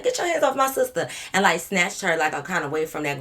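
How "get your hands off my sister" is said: higher, sharp sister telling a man off; mock protective command. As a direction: imitate her snapping at him, a bit higher. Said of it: "she was like".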